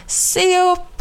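high, sharp cry of warning; warning yell. A narrator's reading. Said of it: "skrek Christopher Robin".

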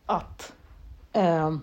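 ordinary talk

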